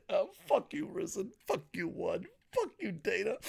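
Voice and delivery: high, soft, hammed-up whimper; mock-tearful cursing. Pride fan cursing Risen, One and Dana, mock-tearful cursing. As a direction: curse them in a small high voice.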